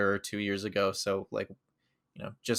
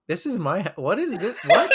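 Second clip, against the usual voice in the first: higher, bewildered mock stammer; imitating confusion; 7 semitones higher, 5 dB louder than usual. Wife finding a stranger at her door, imitated confusion. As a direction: imitate a baffled wife, pitch climbing. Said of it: "she was so confused because she's like".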